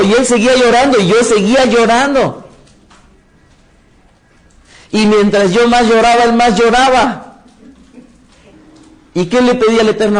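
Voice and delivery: the two men crying, told at full fervor; impassioned preaching. Real, not acted. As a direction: preach it with rising emotion. Climax of crying story.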